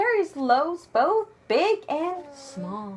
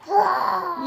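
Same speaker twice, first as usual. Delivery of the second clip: louder roar with a laugh; 6 semitones higher, 5 dB louder than usual. Pretend. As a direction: roar back playfully. Playful roaring with child.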